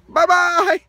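high, loud sing-song goodbye; bouncy farewell; cheerful bye-bye. Real, not acted. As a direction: wave off the viewers brightly.

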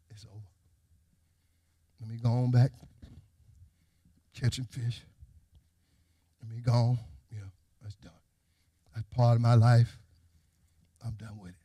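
very low, soft resignation; defeated Peter. Acted. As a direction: give up, with long pauses between phrases. Voices Peter giving up.

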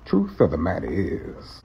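Morgan Freeman voice. Shown as a demonstration. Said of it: "find a longer phrase".

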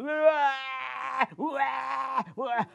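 high hammed-up crying wah wah; mock wailing. Gundul bawling loudly, high mock wail. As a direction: bawl like a toddler. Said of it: "Tangisnya keras sekali".

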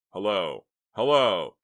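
loud, rising in urgency; calling out. A narrator's reading. Calling into cut-off phone line.

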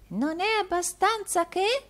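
put-on very high puzzled old woman; deaf old housekeeper. A narrator's reading. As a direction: ask back as if you missed the word.

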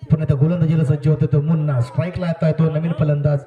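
ordinary delivery

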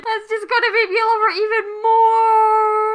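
high, loud, overjoyed wail; rising into a delighted high cry; gushing squeal. Real, not acted. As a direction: wail it with overwhelmed fan love. Gushing over character being Australian.

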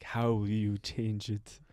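low, slow, soft question; slow and ominous; eerie CEO. Retold or imitated. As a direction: probe like a calm vampire. Imitating eerie CEO's question.

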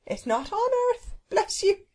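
high, cheery character voice; happy cook. Acted. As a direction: answer blithely, pitched well up. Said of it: "replied the cook happily".

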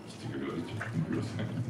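muy bajito, casi para sí; aparte por lo bajo. Real, not acted. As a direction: aside dropped low and soft. Se le escapa el nombre, aparte. (aparte en voz muy baja)

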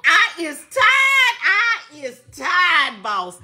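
hammed-up weariness, words hugely drawn out; dramatically weary. Host telling viewers how tired she is, hammed-up weariness. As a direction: stretch out TIRED in a long mock wail. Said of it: "I'm tired as hell".

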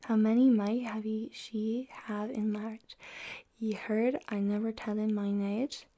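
whispered Middle English reading; library whisper. Acted. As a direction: read the old verse in a hushed whisper. Reads Chaucer aloud in whisper.

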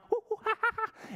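high hooting monkey giggle; high silly monkey laugh. Pretend.

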